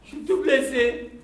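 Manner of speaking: hurt animal voice. Acted. Wolf complaining he is hurt, high and loud. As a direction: cry it out, high and wounded.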